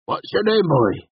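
high rustic questioning; blunt tavern regular. A narrator's reading. Cobb asking the stranger's name, blunt. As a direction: quiz the newcomer bluntly.

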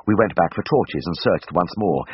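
usual speaking voice

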